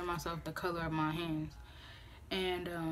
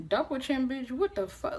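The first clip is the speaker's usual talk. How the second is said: high, loud disbelieving yelp; startled high cry of disbelief; shocked outburst; 7 semitones higher, 4 dB louder than usual. Retold or imitated. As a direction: blurt it out in shock. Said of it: "I was like".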